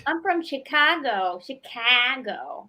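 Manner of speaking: slow, drawn-out Chicago accent, Fargo light. Shown as a demonstration. Guest demonstrates her Chicago accent for the host, slow and nasal-sounding. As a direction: lean into the Midwestern Chicago accent, slowly. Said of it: "it's kind of like Fargo Light".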